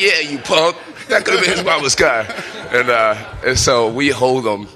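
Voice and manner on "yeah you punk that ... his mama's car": loud high jeer at the thief; yelling at the caught thief, loud mocking taunt; taunting shout. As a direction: rub it in his face, loudly.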